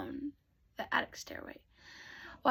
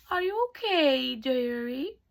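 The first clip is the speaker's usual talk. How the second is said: fairy godmother; slower than usual. Acted. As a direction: sing out a sweet, concerned question. Fairy godmother's voice first heard.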